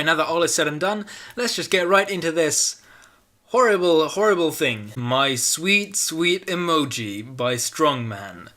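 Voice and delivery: normal talking voice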